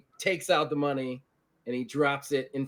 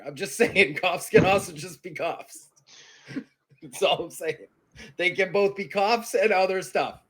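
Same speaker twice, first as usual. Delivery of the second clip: ordinary talk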